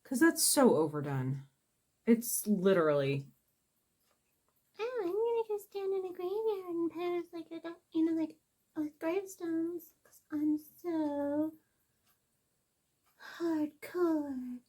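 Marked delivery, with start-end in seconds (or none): 4.8-14.6 high-pitched mocking voice; mock goth poser. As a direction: ridicule a self-styled hardcore goth. Mocks a posing goth.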